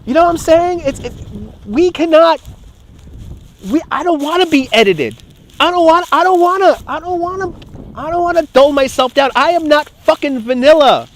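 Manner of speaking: high-pitched, worked-up protest; upset outburst. Real, not acted. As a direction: push the voice high in real exasperation. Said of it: "Getting so upset again".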